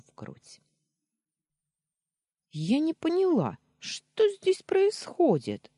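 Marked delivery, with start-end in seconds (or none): 2.5-5.7 very high, loud cry of disbelief; astonished exclamation. A narrator's reading. Wife finding husband hugging another woman, astonished exclamation. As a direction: exclaim it, pitched way up. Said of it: "Раздался удивлённый возглас".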